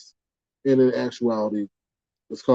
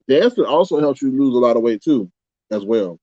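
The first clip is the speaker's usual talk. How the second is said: normal talking voice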